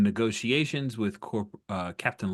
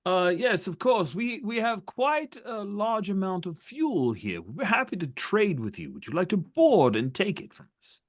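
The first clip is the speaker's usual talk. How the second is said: high-pitched, over-polite officer voice; placating enemy captain; 11 semitones higher than usual. Acted. Captain offering rebels fuel to trade, high and falsely obliging. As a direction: offer the deal with put-on courtesy.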